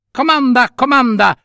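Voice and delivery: vocina molto acuta e pronta; cipolletta magica servizievole. Acted. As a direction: offriti con una vocina fatata. (vocina alta di creatura magica)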